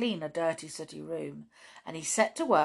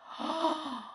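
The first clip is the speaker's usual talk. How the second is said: shocked gasp; 10 semitones higher, 4 dB quieter than usual. Acted. Woski sees the mess.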